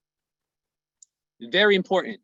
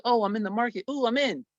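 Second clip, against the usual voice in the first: higher, mock-thrilled trader; lightly hammed-up excitement, pitched up; imitated giddy trader; 6 semitones higher, faster than usual. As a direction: play a pleased newbie clicking buy. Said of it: "that makes you feel good".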